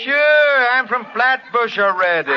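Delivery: put-on high, loud Brooklyn accent. Society doctor owning up to his roots, broad Brooklyn accent. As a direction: burst into broad Brooklyn talk. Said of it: "I came from Brooklyn myself".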